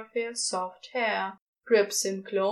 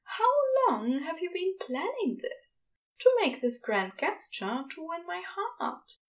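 lightly voiced Aziraphale; 8 semitones higher than usual. Aziraphale teasing Crowley.

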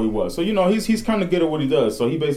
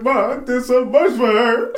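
mock crying whine; 11 semitones higher, 4 dB louder, slower than usual. Retelling crying to his mom.

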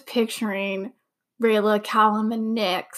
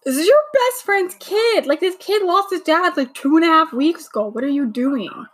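exasperated scolding; 6 semitones higher than usual. Real, not acted. Scolding a character on screen.

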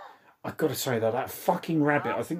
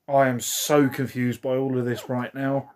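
ordinary talk, nothing marked

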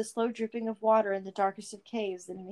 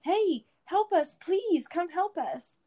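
higher, louder, slow calls for help; desperate shout; 8 semitones higher, 5 dB louder, much slower than usual. Acted. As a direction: call out for rescue across the woods. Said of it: "shouting as loud as she could".

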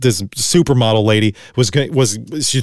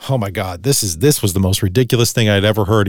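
normal talking voice

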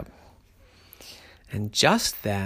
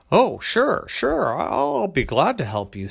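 other bear voice; 8 semitones higher, 6 dB louder than usual. A narrator's reading.

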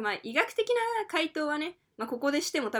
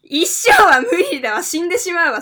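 loud; 4 semitones higher, 13 dB louder than usual. Real, not acted. Retorting loudly.

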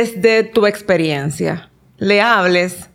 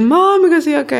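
imitating her little son; 8 semitones higher, 3 dB louder than usual. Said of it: "que él".

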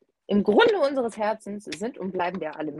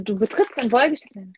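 ordinary talk

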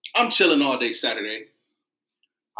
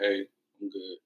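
low, soft, unbothered phone greeting; calm; 6 semitones lower, 7 dB quieter than usual. Retold or imitated. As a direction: report in casually, quite unfazed. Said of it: "why are you so calm?".

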